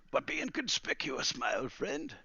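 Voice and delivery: high-pitched gentle warning; creaky old master urging care; old wizard, cautioning. Acted. Wizard warning Calamus to go unseen, cautioning.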